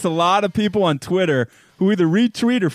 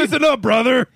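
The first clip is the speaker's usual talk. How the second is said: Hulk Hogan; 3 semitones higher than usual. Retold or imitated. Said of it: "You sound like Hulk Hogan right now".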